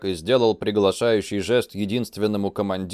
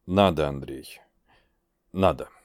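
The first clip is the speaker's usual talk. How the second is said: grave low insistence; 6 semitones lower, 4 dB quieter than usual. Acted.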